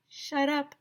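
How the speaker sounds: high, soft shocked line; shocked whisper. Acted. Kids scandalized by the word, high and soft.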